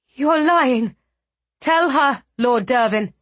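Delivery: high, loud disbelieving cry; shocked outburst. Acted.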